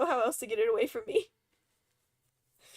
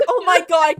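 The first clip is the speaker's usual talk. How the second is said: loud, higher exasperated cry; retold exasperation; 6 semitones higher, 13 dB louder than usual. Streamer answering friends who tease her, mock exasperated. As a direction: protest it loudly, half laughing.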